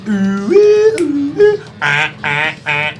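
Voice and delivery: high, loud playful ooh-ah; loud, high playful dance yelps; mock siren dance yelps. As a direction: yelp along to the beat.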